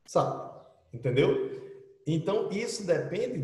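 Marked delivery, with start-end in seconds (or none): 0.1-0.5 "Sup" as one tiny syllable; very clipped. Shown as a demonstration. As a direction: cut "what's up" down to a quick "Sup". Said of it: "Pode fazer um legalzinho assim".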